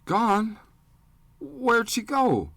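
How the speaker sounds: high, scared little voice, slow at first; a small boy begging to be told it isn't true; frightened boy pleads that his mother will return; frightened child. Acted. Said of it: "even more frightened and confused".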